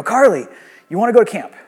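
loud, bright call across the patio; eager shout to a teen after church; excited call. Retold or imitated. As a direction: call her name out, thrilled with the news. Calling the girl back excitedly.

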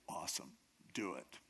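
low, soft, deadpan consent; understated royal go-ahead; quiet approval. Retold or imitated. King coolly approves hanging.

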